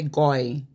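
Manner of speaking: "goy" for guy, with an OY vowel; regional vowel. Shown as a demonstration. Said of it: "they use strange phrases".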